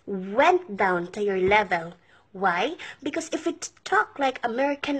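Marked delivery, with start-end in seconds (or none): none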